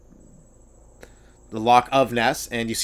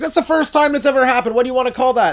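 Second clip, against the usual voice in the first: high, amazed gush; excited golf crowd; 11 semitones higher, 4 dB louder than usual. Retold or imitated. Golf witnesses amazed at the first shot under par, high and gushing.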